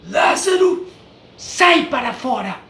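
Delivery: a loud, high shout across the tomb; loud cry. Retold or imitated. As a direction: call him out loudly and high. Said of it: "bradou em alta voz". (grito alto e agudo, chamando Lázaro)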